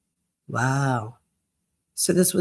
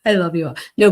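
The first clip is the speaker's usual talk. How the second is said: usual speaking voice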